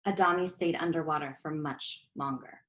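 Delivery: plain reading voice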